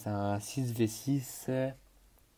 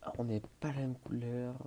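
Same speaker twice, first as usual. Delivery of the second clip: usual speaking voice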